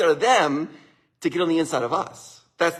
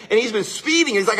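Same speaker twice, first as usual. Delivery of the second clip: plain reading voice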